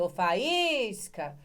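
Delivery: Ô, faísca! called out very slowly, each syllable stretched; called out, drawn out. Shown as a demonstration. Teacher showing how to call out the word, drawn-out call. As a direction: stretch faísca out like calling someone's name. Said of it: "eu vou mostrar pra vocês como é que eu explico".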